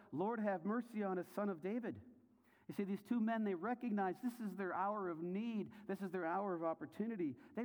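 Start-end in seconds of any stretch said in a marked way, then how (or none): 0.1-2.0 high, insistent acted plea; crying out. Rebuked blind men persisting with Jesus, crying out. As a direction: imitate a determined cry for mercy, pitched up. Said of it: "they cried out all the more".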